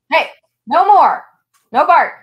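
sharp, loud and high, cutting the barking short; scolding the dog. Real, not acted. Owner yelling at her barking dog to stop, loud scolding. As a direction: shout the dog down, real annoyance.

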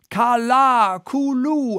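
high, long howl; drawn-out, high-pitched whoop; Queequeg. A narrator's reading. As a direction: howl out a wild rowing cry. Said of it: "howled Queequeg".

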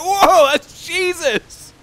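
amazed driver. Pretend. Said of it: "everyone driving past is like".

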